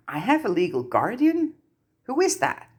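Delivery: high, startled double question; surprised. A narrator's reading. Harry stunned at the goblin, high startled question. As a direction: ask in disbelief, pitch leaping.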